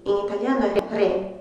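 ordinary delivery

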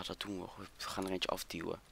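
normal talking voice

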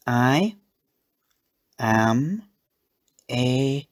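words spaced out, pitch lifting; halting reading. A narrator's reading. Eliza slowly reading out unfamiliar words, halting. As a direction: sound out each word with long gaps. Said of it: "she paused".

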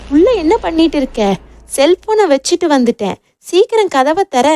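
high-pitched, restrained narrated yell; urgent shouting. A narrator's reading. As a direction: call urgently through a closed door. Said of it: "வெளியே இருந்து காட்டுக்கத்தாய் கத்தினான்".

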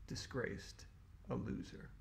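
hushed, breathy aside; mock dramatic hush. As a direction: whisper it like a sad verdict.

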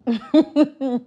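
loud, high burst of laughter; disbelieving laugh. Acted. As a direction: laugh off the suggestion. Laughs off his password guess.

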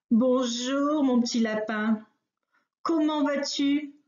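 a jolly bear squeezing a small rabbit; bear's greeting. Acted. Bear greets the rabbit he hugs, over-friendly.

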